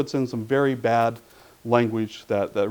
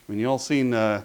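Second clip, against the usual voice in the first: normal talking voice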